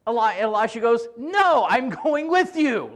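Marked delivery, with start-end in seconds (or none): 1.2-2.9 slow, firm, pitched higher; stubborn slow refusal. Retold or imitated. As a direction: lean on every word, refusing. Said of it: "these guys are just like aggressors".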